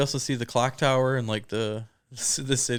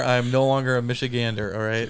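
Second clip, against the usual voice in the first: usual speaking voice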